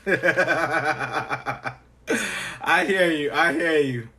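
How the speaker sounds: normal talking voice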